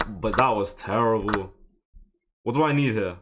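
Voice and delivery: ordinary talk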